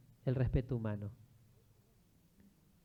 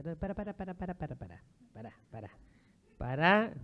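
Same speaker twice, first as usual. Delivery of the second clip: urgent interruption; 3 semitones higher than usual. Retold or imitated. Priest halting a nun slipping into mystical trance, urgent interruption. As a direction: cut in with a quick, hushed rattle.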